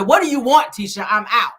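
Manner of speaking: loud, high, irritated demand; annoyed snap. Retold or imitated. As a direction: bark it out like an annoyed husband at a bar. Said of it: "That's how he asked her".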